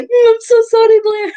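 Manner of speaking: high, loud sheepish sorry; exaggerated sorry to the grandson; mock apology. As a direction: play up the guilty grandmother. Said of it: "I'm like".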